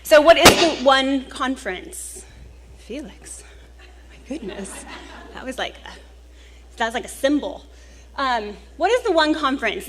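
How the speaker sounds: normal talking voice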